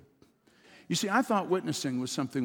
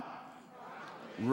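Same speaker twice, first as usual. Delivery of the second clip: congregation response from afar; 3 semitones lower, 11 dB quieter, slower than usual. Real, not acted. Congregation repeats again.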